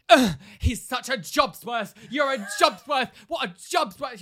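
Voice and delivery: high, loud, sneering mock shout; shouted insult, hammed-up; mock angry yell. Irate customer insulting the cashier, mock shout. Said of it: "she was like".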